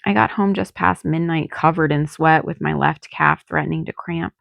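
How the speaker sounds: ordinary delivery, nothing marked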